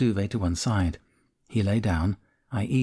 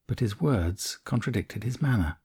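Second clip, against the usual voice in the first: ordinary delivery, nothing marked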